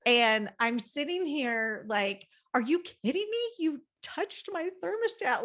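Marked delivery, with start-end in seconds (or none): 2.5-5.4 high, incredulous snap of irritation; exasperated outburst. Retold or imitated. Wife snapping at husband over the thermostat, high exasperated outburst. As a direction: play it like someone just touched your sacred thing.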